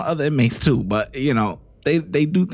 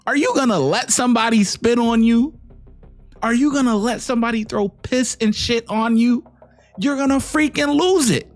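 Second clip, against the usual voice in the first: heated rant; 7 semitones higher than usual. Real, not acted. Heated rant about being spat on.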